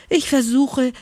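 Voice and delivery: high, strained hare voice, lightly gasping. A narrator's reading. Hare explains his track-making, gasping. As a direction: speak up high like a winded hare. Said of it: "japste der Hase".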